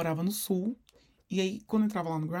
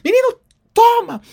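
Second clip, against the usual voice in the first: much higher, louder exasperated yell; fed-up shout; 15 dB louder than usual. Retold or imitated. Mother giving in to her nagging son, fed-up shout. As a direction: mom finally caving in a burst. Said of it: "E até que ela dizia".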